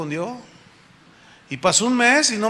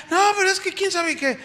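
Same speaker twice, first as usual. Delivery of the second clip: evasive church lady; 11 semitones higher than usual. Retold or imitated. The woman dodges the pastor's question.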